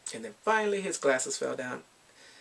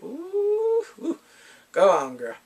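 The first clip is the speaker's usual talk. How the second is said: much higher, louder teasing ooh; sassy squeal; 12 semitones higher, 8 dB louder, much slower than usual. Retold or imitated. Reacting to the heroine's confession, with a loud high teasing squeal. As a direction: cheer her on like a sassy friend.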